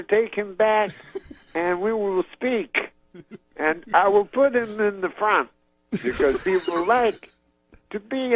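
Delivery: high, slow, halting Cosby voice; Cosby promising to meet the comic's father, high and slow; Bill Cosby impression. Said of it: "he says".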